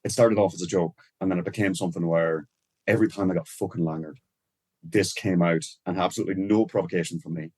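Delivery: relaxed Irish accent, casual. Real, not acted. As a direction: chat in a natural Irish accent. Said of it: "did your whole fucking accent just change".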